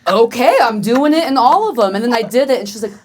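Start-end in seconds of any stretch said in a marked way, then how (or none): 0.1-1.9 high, loud mock panic compliance; hammed-up yelp of obedience; exasperated surrender. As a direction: give in loudly and hurriedly to an order. Giving in to wife, acted.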